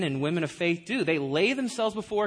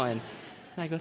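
normal talking voice

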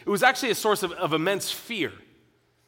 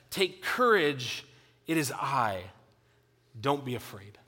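gentle reassurance; 6 semitones lower, 4 dB quieter than usual. Acted. Jesus quoted reassuring disciples.

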